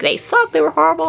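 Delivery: high-pitched mock complaint; mocking a harsh critic. Imitating somebody who dunks on them, mocking high voice. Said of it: "somebody does dunk, like, a whole lot".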